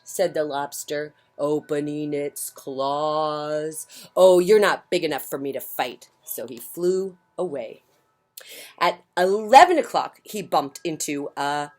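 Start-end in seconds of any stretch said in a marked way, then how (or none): 4.2-6.1 loud dismissive brush-off; grouchy ladybug bluster. Acted. Grouchy ladybug backing off from a fight, loud and huffy. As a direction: back down while acting tough.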